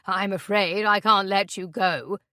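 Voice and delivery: sinister voice. Acted. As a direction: threaten slowly, with menace. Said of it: "he says in a sinister voice".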